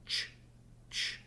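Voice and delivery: "Ch, ch" twice with no voice; a voiceless, breathy "ch, ch"; repeated hushed "Ch, ch"; unvoiced ch sound. Shown as a demonstration. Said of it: "So if you feel your throat".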